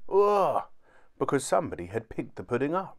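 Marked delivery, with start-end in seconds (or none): 0.1-0.6 startled grunt. A narrator's reading. Tom is picked up.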